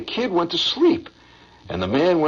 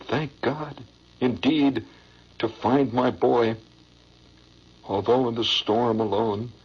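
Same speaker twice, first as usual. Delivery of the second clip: poem recitation; 7 semitones lower than usual. Acted. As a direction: recite it like a heart-tugging stage ballad. Recites the poem's ending.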